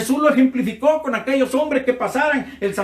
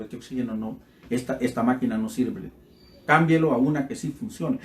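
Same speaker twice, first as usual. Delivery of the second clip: calm doctor; 9 semitones lower, 5 dB quieter than usual. Retold or imitated. Doctor gives firm order.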